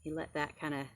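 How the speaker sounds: put-on loud, lively read-aloud to kids; storybook reading. Teacher reading a picture book to kids, lively and loud. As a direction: read the story big and bright for the class.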